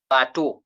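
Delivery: "bato" said as "ba-to", final -eau as a clear O; model pronunciation. Shown as a demonstration. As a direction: sound out "bato" with the O ending for the class. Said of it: "read it as".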